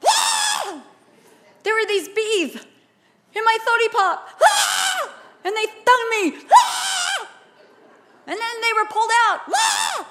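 high, lisping bawl broken by wails; hammed-up tearful kid, loud and high; crying child. Praying like the stung son in the throne room, high wailing lisp. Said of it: "I'm just like".

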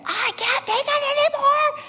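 high, strained cry of a frantic woman; desperate woman. Retold or imitated. A woman in church crying out, high frantic voice. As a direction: shriek it like she's at the end of her rope.

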